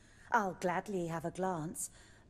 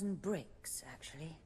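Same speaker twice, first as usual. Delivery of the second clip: dropped to a whisper; 5 semitones lower, 7 dB quieter than usual. Real, not acted. [name] warns of rough crowds.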